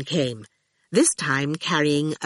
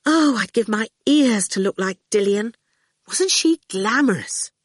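higher, swooning sigh of envy; sighing admiration; 6 semitones higher, 3 dB louder than usual. A narrator's reading. Fifi, outside the gate, envies Dillion, sighing heavily. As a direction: sigh it out with rapt longing. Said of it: "she just sighed heavily".

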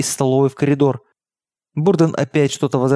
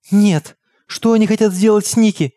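high, anxious protest; alarmed child's question; 7 semitones higher than usual. A narrator's reading. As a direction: ask in a scared, pitched-up voice. Scared girl refusing her sister. (встревоженный детский вопрос, высоким голосом)

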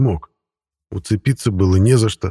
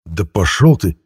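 angry retort. A narrator's reading. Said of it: "Совсем уж разозлился я".

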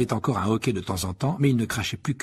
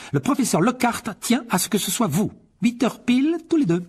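much higher, crisp stern teacher; McGonagall; 12 semitones higher, 4 dB louder than usual. A narrator's reading. McGonagall catching the boys in the hall, stern and high. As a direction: address them like a strict professor.

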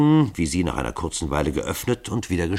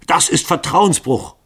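much higher, louder, slow furious cry; angry outburst; 11 semitones higher, 7 dB louder, slower than usual. A narrator's reading. Lycoville angrily accusing Hall of betrayal. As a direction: hurl the accusation in anger. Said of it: "rief Lycoville zornig".

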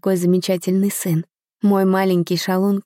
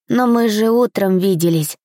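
ordinary delivery

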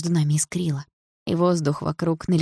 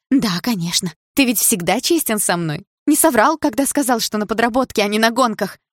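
sarcastic accusation; 6 semitones higher, 5 dB louder than usual. A narrator's reading.

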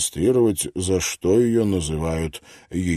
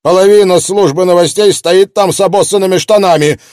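furious editor; 11 semitones higher, 11 dB louder than usual. Acted. Angry editor woman.

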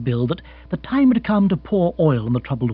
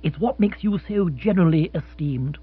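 gushing praise; 10 semitones higher, 6 dB louder than usual. Acted. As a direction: lay the praise on thick. Lord gushing.

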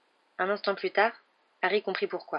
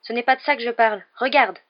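higher, louder, urgent; panicked; 5 semitones higher, 7 dB louder than usual. A narrator's reading. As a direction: point it out in alarm. Ron panicking over the letter.

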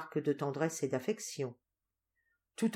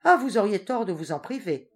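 louder, quick cut-in; brisk interruption; 4 semitones higher, 8 dB louder than usual. A narrator's reading. Duenna interrupting Doña Rosario, lively. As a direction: jump in briskly. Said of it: "interrompit la duègne avec vivacité".

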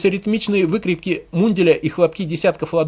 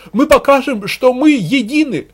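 high, loud rabble-rousing shout; propagandist's fervent slogan; rallying cry; 9 semitones higher, 6 dB louder than usual. A narrator's reading. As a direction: rouse the crowd with each word.

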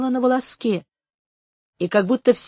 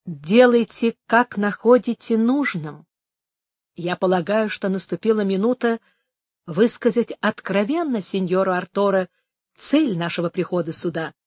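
plain reading voice

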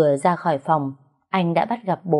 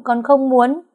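higher and louder, nearly crying out; escalating refusal; 7 semitones higher, 4 dB louder, slower than usual. Acted. Daughter insists to her father, voice rising higher and louder. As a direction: push the repeat up into a pleading outburst. Repeated refusal, second take.

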